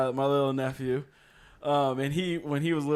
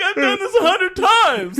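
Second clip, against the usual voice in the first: laughing; 13 semitones higher than usual. Retold or imitated. Nephew voiced while laughing.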